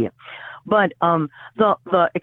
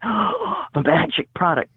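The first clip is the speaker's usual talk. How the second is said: mock reverent awe; slower than usual. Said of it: "putting it up on a pedestal and going".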